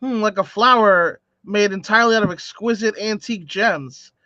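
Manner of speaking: normal talking voice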